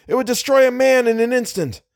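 high-pitched shouted order; urgent shout. A narrator's reading. Driver orders the light aimed ahead, shouting. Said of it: "shouted Little".

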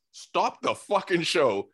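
imitating a podcast host halting everything; mock urgent announcement. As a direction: drag each word out like breaking news. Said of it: "Every podcast is just like".

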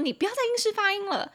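high, quick mock scolding; sister's scolding. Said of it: "我前两天还被我姐骂".

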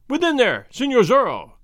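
loud high call through the door. A narrator's reading. Caballero hailing Zorro through the door, loud call. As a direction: call out to the man inside. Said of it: "one cried".

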